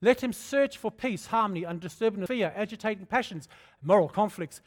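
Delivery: fervent pulpit scripture reading; preached scripture. Acted. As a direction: proclaim the verse to the congregation. Preaching the verse.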